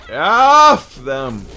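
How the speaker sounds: sudden loud, high angry shout; angry outburst. Real, not acted. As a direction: spit it out in fury. Angry outburst about being fired.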